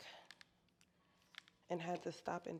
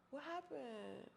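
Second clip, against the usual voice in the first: surprised; 6 semitones higher, 4 dB quieter, slower than usual. Real, not acted. Gimbal suddenly dies mid live.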